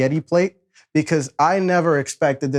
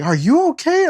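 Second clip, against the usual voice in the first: concerned woman; 13 semitones higher, 3 dB louder than usual. Retold or imitated. Meter maid checking on him.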